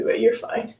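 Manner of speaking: normal talking voice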